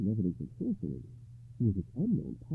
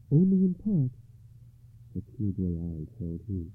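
higher, lightly voiced enchanted lady; gentle lady; 6 semitones higher, 4 dB louder than usual. A narrator's reading. Ragnall sets Gawain a choice, gentle and higher. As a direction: lay out the choice with care.

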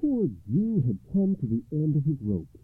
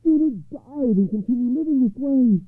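high-pitched wailing poor man; desperate, high-voiced complaint; crying out in distress; 4 dB louder than usual. A narrator's reading. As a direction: lament your troubles in a very high voice. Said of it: "he cried".

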